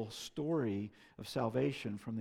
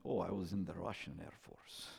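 lightly imitated Russian accent, low and soft; a hint of a Russian accent, pitched down; 5 semitones lower, 8 dB quieter than usual. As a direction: quietly mimic a Russian man's accent. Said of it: "said in his accent".

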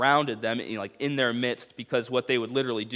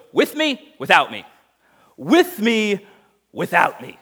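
high, loud, exaggerated with me, without me; hammed-up movie star repeating his line; overacting Tom Cruise; 9 semitones higher, 10 dB louder than usual. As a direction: overplay the line like a cheesy movie. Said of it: "Tom Cruise overacting".

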